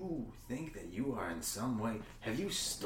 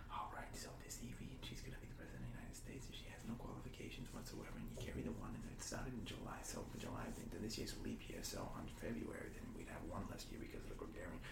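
quick soft whisper to oneself; muttered whisper calculations; 12 dB quieter, faster than usual. Acted. Working out who should be president, a fast whisper. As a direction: whisper your sums under your breath.

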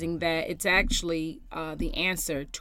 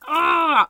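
loud, high exasperated "ah"; re-enacted cry of frustration; frustrated groan; 10 semitones higher, 11 dB louder than usual. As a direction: let out an exasperated yell. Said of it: "I'm getting frustrated".

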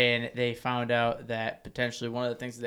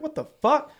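high, louder what the fuck; shocked outburst; 7 dB louder than usual. Retold or imitated. Finding clean plates back in sink, high shocked outburst. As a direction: blurt it in disbelief. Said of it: "And I was like".

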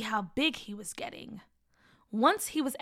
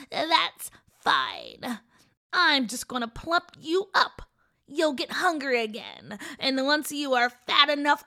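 gloating witch; 3 semitones higher, 6 dB louder than usual. Acted. Witch gloats over the boy.

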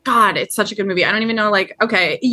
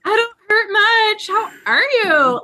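high-pitched mock casual chat; Colleen; 12 semitones higher than usual. Co-host mimicking the pinned wife's calm talk, high and comic. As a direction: imitate a wife chatting on, absurdly high. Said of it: "Like, she's just like, Colleen".

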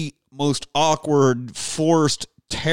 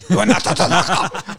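half-choked laughing line; choking on the words; 2 semitones higher, 4 dB louder than usual. Retold or imitated. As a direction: force it out as if choking. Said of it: "Like he's almost choking on it".

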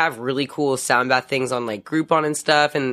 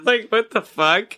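slow mock bewilderment; 6 semitones higher, slower than usual. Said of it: "they'd be like".

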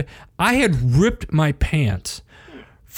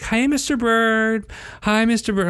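sing-song greeting; 8 semitones higher than usual. Retold or imitated. Teachers greeting a dad in the hallway, sing-song. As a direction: imitate overly friendly teachers, pitched up.

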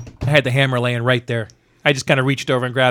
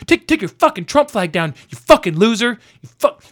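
high-pitched angry rant, sputtering on the curses; mad ranting; 7 semitones higher than usual. Retold or imitated. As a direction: imitate a furious heckler, pitch climbing high. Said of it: "this guy was just mad against the world".

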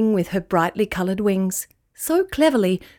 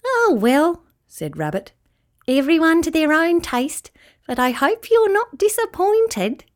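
dismissive rabbit; 8 semitones higher than usual. A narrator's reading. Rabbit shrugging.

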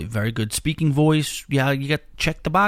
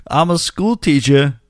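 slow, louder country drawl on "I'm a school teacher"; mimicking caller's drawl; 2 semitones higher, 4 dB louder than usual. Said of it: "I gotta stop it right there".

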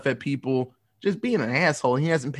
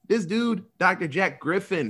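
ordinary talk

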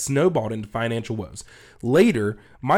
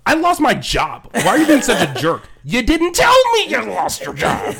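loud, rising, outraged; mock angry quarrel; 8 semitones higher, 6 dB louder than usual. Friend yelling back at the others, much higher and louder. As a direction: yell it like a fed-up friend.

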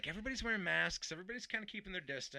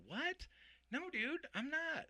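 startled denial; 13 semitones higher, much slower than usual. Retold or imitated. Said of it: "And I'm like".

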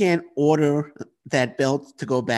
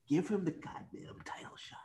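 breathy intense whisper; urgent whispered demand; 7 semitones higher, 15 dB quieter than usual. Acted. Whispered plea to the booker.

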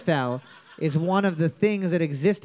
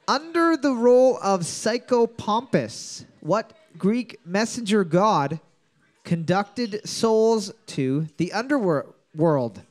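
slow quiz reading; slower than usual. Acted. Host reads question slowly.

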